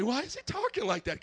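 lightly voiced confused churchgoer; puzzled listener. Pastor voicing a confused churchgoer, mildly puzzled. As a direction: ask it with mock puzzlement. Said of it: "Some of y'all look at me".

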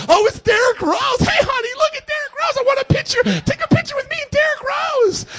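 very high, loud fangirl squeal; squealing schoolgirl. Acted. Preacher mimics grown men fawning over a star, schoolgirl squeal. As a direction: shriek like a starstruck little schoolgirl, hammed-up. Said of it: "dudes, like, little schoolgirls around him".

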